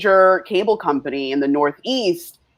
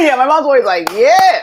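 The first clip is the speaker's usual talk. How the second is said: laughing, higher and louder; 7 semitones higher, 6 dB louder than usual. Real, not acted. Friend laughing while quoting her mom. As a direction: laugh through the words.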